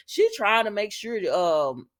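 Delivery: normal talking voice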